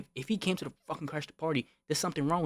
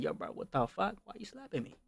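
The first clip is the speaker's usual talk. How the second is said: mock surprise; 5 semitones higher, 3 dB quieter than usual. Slapped friend asking why, imitated high surprise. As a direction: pitch jumping up, softer at the end. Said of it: "he got so surprised".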